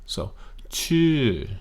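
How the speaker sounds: Chu high, rising then falling steeply; falling tone on Chu with a tight throat. Shown as a demonstration. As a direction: climb on Chu then drop it well down. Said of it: "You go up and you come down, and you come down considerably further".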